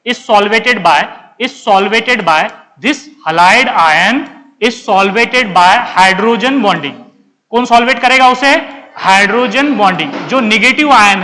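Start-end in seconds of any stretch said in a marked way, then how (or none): 0.0-7.0 very slow, word by word; slow dictation. Real, not acted. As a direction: dictate with drawn-out words and short gaps. Teacher dictates notes in English. (एक-एक शब्द धीरे लिखवाते हुए)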